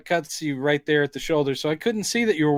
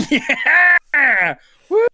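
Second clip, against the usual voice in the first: laughing high, louder ape whoop; cheering chimp; 10 semitones higher, 6 dB louder than usual. Retold or imitated. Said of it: "cuts to a chimp going".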